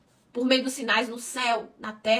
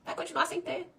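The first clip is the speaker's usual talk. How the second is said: softer ironic echo rising on "sem"; ironic repetition; 5 semitones higher, 6 dB quieter than usual. Retold or imitated. Pastor echoing a follower's doubt back to the church, ironic. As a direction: repeat "Vai continuar sem ter" softly with a rising, knowing lift on "sem". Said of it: "Parabéns, já declarou".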